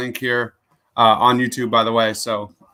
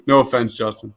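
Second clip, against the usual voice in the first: ordinary delivery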